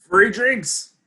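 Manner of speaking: high, loud delighted outburst; excited exclamation. Acted. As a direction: pipe up loudly, thrilled at the offer. Reacts to bar tab being paid.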